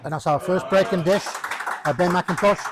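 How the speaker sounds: bright announcer call. Acted. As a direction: announce it to the room. Announces the break and dish.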